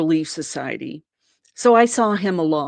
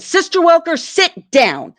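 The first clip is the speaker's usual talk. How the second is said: much higher and louder order; stern command; 10 semitones higher, 8 dB louder than usual. Retold or imitated. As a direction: bark the name, then sit down. President ordering her back.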